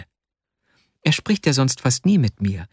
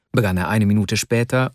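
ordinary delivery, nothing marked

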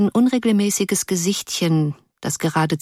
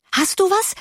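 much higher, eager rising question; expectant cry; 14 semitones higher than usual. A narrator's reading. Schoolgirls crying out expectantly to their friend, eager. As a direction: ask eagerly, voice leaping up on du. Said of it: "schrien sie erwartungsvoll".

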